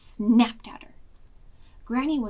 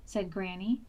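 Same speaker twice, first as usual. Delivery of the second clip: ordinary delivery, nothing marked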